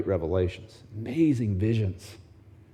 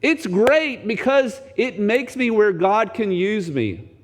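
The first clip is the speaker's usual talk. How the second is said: much higher, gleeful yell of Paul's joy, then slow; mock cheer; 9 semitones higher, much slower than usual. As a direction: cheer it like a delighted child. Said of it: "Can you say, as Paul does".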